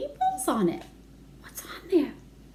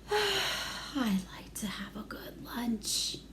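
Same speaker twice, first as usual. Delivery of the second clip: slow, breathy whisper; wistful whisper; 8 dB quieter, much slower than usual. Acted. Boy sighing over his lunch, whispered. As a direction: sigh the words out slowly. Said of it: "Albert sighed".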